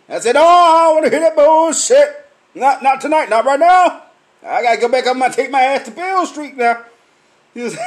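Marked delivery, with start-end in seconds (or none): 0.4-6.8 loud, high dismissive refusal; loud brush-off. Retold or imitated. Cutting off the coworker before he says he sounds like someone, loud. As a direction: shut him down before he starts.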